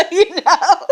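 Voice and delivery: high, loud laughing words. Real, not acted. Worker retelling their reply to the manager, laughing. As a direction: burst out laughing through the words.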